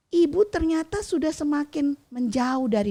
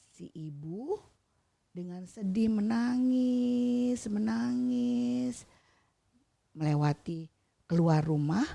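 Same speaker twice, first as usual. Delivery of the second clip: usual speaking voice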